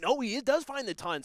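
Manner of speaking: sudden burst up high and loud; excited call. Real, not acted. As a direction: call the big score with a jolt. Big score in live commentary.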